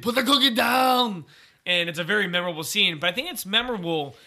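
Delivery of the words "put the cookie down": high, loud mock action-star yell; slow, forceful imitation of a movie star's outburst; Arnold Schwarzenegger. Host imitating Arnold on the phone to Ted, loud drawn-out yell. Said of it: "Um, and he's like".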